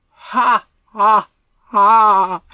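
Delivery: loud, slow ghostly ha ha ha; spooky ghost laugh. A narrator's reading. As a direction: laugh like a haunting. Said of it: "the spooky figures laughed".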